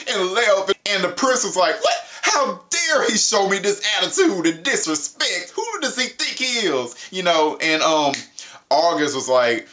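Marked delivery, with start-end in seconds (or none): 1.8-6.9 mock outrage. Prince outraged at monster's attitude.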